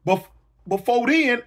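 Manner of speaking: normal talking voice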